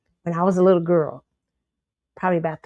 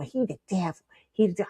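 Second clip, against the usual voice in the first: inner thought; 5 dB quieter than usual. Retold or imitated. Little girl inside the church thinking to herself, hushed. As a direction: say it softly to yourself.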